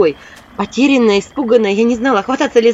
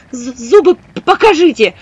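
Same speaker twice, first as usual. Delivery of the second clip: frightened stammer; 6 semitones higher, 7 dB louder than usual. A narrator's reading. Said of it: "попросила я чертей".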